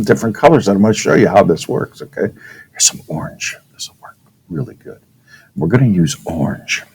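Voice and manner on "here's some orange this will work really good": very soft, whispered aside; murmured to yourself while busy; under the breath. Real, not acted. Teacher picking out marker colors, under the breath.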